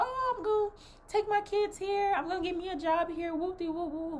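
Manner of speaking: high, fast mocking voice; high mocking classmates. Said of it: "Everybody was like".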